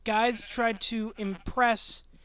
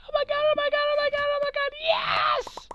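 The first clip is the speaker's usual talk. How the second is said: much higher, shrieking oh my God, louder; girl tweaking out; 4 dB louder than usual. Retold or imitated. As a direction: squeal like a movie girl who just got asked out. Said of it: "the girl goes".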